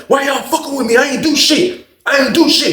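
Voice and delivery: high, indignant protest; snapping. Retold or imitated. Inmate protesting his innocence to the guards, high snapping. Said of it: "He snapping like that".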